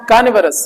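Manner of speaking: कानिवरस with both r's silent. Shown as a demonstration. Teacher class को: कानिवरस, दोनों आर silent. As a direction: say कानिवरस with no r sounds. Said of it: "दोनों आर silent है".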